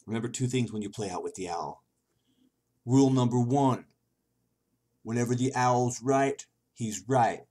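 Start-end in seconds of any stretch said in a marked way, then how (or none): 2.9-7.4 loud, higher, emphatic rule-giving; bossy owl announcer. Acted. Owl laying down the rules of its game, loud proclaiming. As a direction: proclaim each rule with pompous force.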